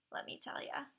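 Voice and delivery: a hushed little whisper; confiding whisper. Real, not acted. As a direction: lean in and whisper it.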